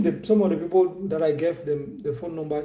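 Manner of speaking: ordinary delivery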